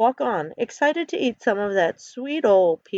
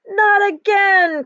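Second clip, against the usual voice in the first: long, drawn-out high yell; 12 semitones higher, 5 dB louder, much slower than usual. A narrator's reading. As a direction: stretch it out in dismay, high and loud. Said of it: "Ken yells".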